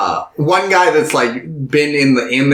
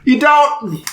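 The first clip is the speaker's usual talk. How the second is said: much higher mocking rant; mock scolding Kikuchiyo; 10 semitones higher than usual. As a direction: scold the cowards in a goofy high voice.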